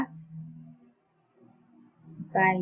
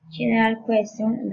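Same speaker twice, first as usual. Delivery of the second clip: usual speaking voice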